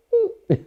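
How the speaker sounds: put-on high bright baby giggle; father mimicking his daughter's happy laugh; imitated baby giggle.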